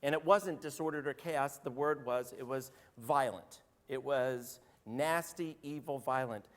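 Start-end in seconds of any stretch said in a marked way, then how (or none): none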